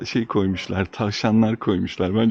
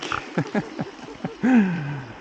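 light amused laugh; short chuckle at own joke; 7 semitones higher than usual. Real, not acted.

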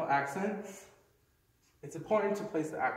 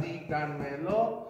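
"díganmelo" slowly divided into four syllables; slow four-part breakdown of "díganmelo"; 2 semitones lower, slower than usual. Shown as a demonstration. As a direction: sound out "díganmelo" piece by piece. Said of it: "if you look at the number of syllables".

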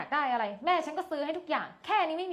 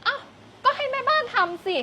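indignant retort; 11 semitones higher than usual. Acted. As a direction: scoff that the maid should do it. Spoiled girl refuses mopping.

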